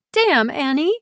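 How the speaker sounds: moved Pri. Acted. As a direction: caught off guard by feeling. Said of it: "brushing a tear from her face".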